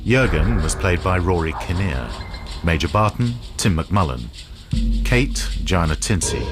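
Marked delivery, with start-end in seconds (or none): none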